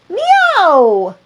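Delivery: long falling meow; a cat's drawn-out cry; high meow. Acted. The cats call out together, high falling meow. Cat chorus.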